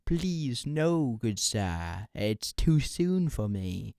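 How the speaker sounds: hammed-up begging, slowing at the end; mock pleading. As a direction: plead theatrically for mercy. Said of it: "He can't hear you plead".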